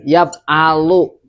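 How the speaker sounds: "yab alu" drawn out very slowly. Retold or imitated. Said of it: "Ada yang bilang yab".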